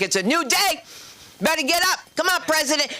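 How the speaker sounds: high, insistent rousing call; acted wake-up call. Wife rouses the President for a new day, insistent call. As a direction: chivvy someone out of bed.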